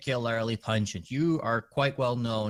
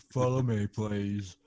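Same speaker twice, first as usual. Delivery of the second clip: lopsided funny NPC voice; side of mouth. Acted. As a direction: talk out of one side of the mouth. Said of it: "talking out of the side of my mouth with a funny voice".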